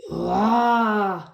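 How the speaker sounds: loud cartoon crying wah; crying wail. George after dropping his dinosaur, crying wail. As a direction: wail it like a toddler. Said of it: "George starts to cry".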